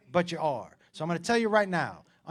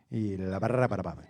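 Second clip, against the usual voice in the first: mock Spanish gibberish; 10 semitones lower than usual. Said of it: "it sounded like this".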